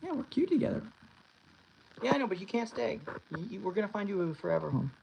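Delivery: soft sing-song pet talk. Real, not acted. Cooing to the foster cat.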